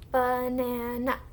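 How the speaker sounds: Banana with each syllable stretched and joined; slowly blended. Shown as a demonstration. Teacher blends syllables for the class, slowly blended. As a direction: draw Banana out slowly without gaps. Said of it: "the faster you say it".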